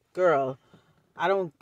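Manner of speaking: normal talking voice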